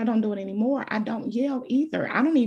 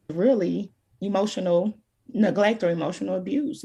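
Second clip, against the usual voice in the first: ordinary talk, nothing marked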